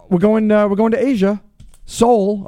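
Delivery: radio shout-out. Acted. Shout-out announcement.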